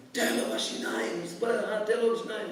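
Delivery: cheering fight fan. Acted. Fan urging on boxer number one, high mock yell. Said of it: "and the guys yelling".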